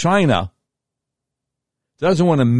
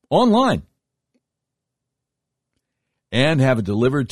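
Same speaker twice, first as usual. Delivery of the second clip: ad read. Acted. Live ad read.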